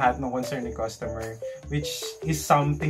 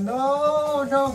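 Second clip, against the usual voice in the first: high panicked no, no; yelling no; 11 semitones higher, 3 dB louder than usual. Retold or imitated. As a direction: cry out no in a panic. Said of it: "nagsisigaw kami". (sumisigaw ng hindi)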